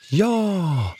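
put-on high, eager shout of many kids; high children's chorus yell; children shouting together. A narrator's reading. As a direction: shout it like a group of excited children. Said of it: "ropar barnen i kör".

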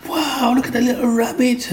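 put-on high, excited kid's voice; amazed child. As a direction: show it off like a thrilled child. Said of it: "I was like".

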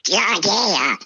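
garbled quacking duck voice; Kalle Anka. Acted. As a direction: talk like a cartoon duck, out of one side of the mouth. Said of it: "kan prata som Kalle Anka".

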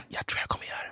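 whispered confession; whispering to a friend that he'll step in. Retold or imitated. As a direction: breathe it like a secret. Quiet reply to friend at pizzeria.

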